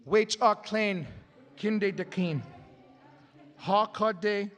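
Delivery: slow, solemn chanted oration; ceremonial recitation. Acted. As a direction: intone each line with reverence. Ceremonial song words recited, translated.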